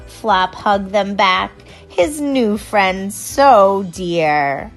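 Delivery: plain reading voice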